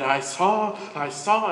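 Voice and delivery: struggling to get it out; breathless excitement. A narrator's reading. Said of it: "caught her breath and said".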